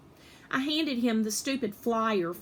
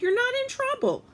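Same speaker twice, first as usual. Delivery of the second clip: much higher, gentle reassurance; reassuring teacher. Acted. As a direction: soothe a worried kid.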